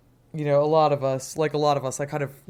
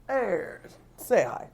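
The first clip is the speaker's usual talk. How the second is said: cooing to a cat; 7 semitones higher, much slower than usual. Real, not acted. Talking to the cat.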